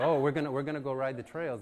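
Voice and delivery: gleeful, teasing tone; cheerful announcement. Retold or imitated. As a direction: friends breezily springing the news. Friends announce the trail ride.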